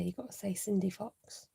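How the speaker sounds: ordinary delivery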